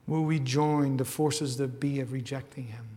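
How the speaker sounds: ordinary delivery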